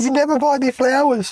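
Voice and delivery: squealing high, loud fake sulk; whiny mock complaint. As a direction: play the jilted partner, shrill.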